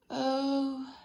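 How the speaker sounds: a stretched, yawning oh. Acted. Mister Forgetful waking up in the sun, yawning. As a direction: yawn it out as you wake. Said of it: "he yawned".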